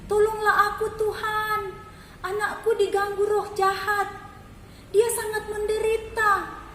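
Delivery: high and loud, desperate begging; crying out for help. A narrator's reading. Mother still following behind, high loud desperate plea. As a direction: keep calling out, pleading with everything. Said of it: "terus berteriak minta tolong".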